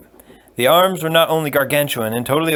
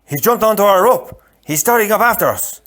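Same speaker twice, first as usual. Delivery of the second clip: higher, louder urgent warning; alarmed Spaniard; 9 semitones higher, 5 dB louder than usual. Acted. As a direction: raise the alarm. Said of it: "the Spaniard said".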